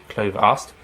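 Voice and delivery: plain reading voice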